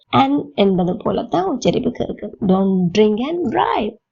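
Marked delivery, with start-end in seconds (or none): none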